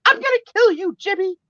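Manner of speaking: high, loud imitation of an exasperated aunt; mock threat. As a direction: cry out a playful death threat. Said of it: "she would say".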